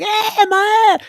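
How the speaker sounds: put-on very high, loud choked little girl's cry; mock child's shriek. Imitating the little girl at the rail, high strangled shriek. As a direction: shriek it high like a horrified kid. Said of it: "that little girl is like".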